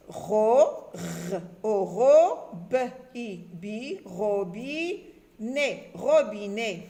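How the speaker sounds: letters, then syllables, then blend ROBINET slowly; syllable-by-syllable reading. Shown as a demonstration. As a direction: sound out ROBINET bit by bit, stretching each piece. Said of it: "on lit les deux syllabes". (déchiffrer ROBINET syllabe par syllabe)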